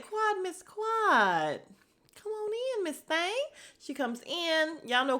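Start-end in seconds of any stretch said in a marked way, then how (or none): 0.0-3.4 high and very slowly drawn-out welcome; greeting Quad at the door, high and drawn out; welcoming hostess. Retold or imitated. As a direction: stretch every word like a grand greeting. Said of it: "I said".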